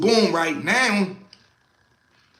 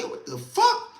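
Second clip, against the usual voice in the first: startled high yelp; 3 dB quieter than usual. Retold or imitated. Startled by banging.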